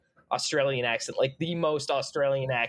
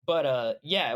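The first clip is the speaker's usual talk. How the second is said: ordinary talk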